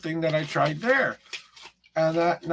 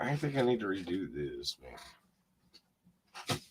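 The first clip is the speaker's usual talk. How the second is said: low rough voice giving out; hoarse, much lower, fading almost to breath; gravelly voice; 8 semitones lower than usual. Real, not acted. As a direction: croak through it as the voice fails. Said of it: "I got kind of a gravelly voice there".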